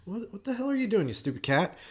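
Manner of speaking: sharp, high, fast telling-off of a pet; exasperated yell at a noisy cat; scolding the cat. Real, not acted. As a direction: snap at the cat that's making a racket. Scolding cat knocking things about.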